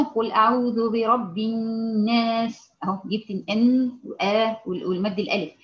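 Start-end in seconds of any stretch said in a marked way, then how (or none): 0.0-2.7 slow recitation holding each long vowel. Shown as a demonstration. Teacher showing the long vowels while reciting. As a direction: draw out the long vowels clearly. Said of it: "عشان نجيب مدود مثلًا".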